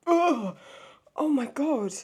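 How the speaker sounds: loud, high recoil; startled disgust. Real, not acted. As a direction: burst out loudly in shocked revulsion. Startled revulsion at what she watches.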